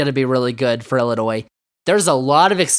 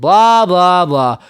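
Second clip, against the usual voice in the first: mocking boaster. Sports host mocks the Big Ten's overrated boasting, mocking.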